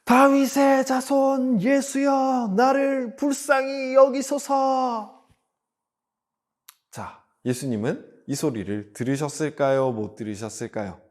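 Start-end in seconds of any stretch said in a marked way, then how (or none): 0.0-5.3 high, very drawn-out shout at full voice; louder crying out. Acted. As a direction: wail the plea out slowly for all to hear. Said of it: "아까보다 더 큰 목소리로". (더 큰 목소리로 길게 부르짖기)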